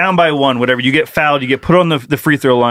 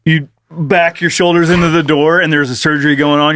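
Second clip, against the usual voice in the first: ordinary delivery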